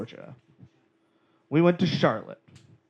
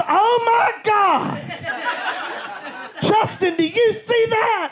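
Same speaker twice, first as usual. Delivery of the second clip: shrieking aunt; slower than usual. Retold or imitated. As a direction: scream in awe at the trash can. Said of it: "And she goes".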